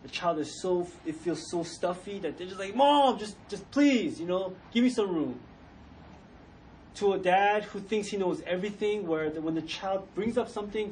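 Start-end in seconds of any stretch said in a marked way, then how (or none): 2.8-5.4 exasperated plea. Retold or imitated. Stifled child begs hovering mom for room, exasperated plea. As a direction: plead to be left alone, mildly exaggerated.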